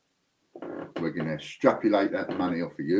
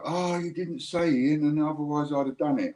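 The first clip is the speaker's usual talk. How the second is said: whiny viewer; 9 semitones higher than usual. Retold or imitated. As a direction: whinge like a moaning viewer. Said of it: "you go".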